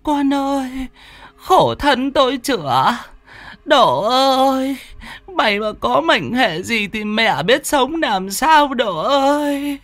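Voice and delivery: high, drawn-out motherly lament; acted keening, stretched out on the calls; loud wailing. A narrator's reading. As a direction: wail slowly over your stricken child. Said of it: "miệng khóc bổ lô".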